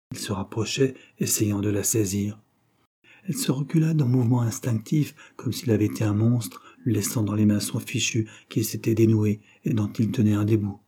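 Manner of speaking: ordinary delivery, nothing marked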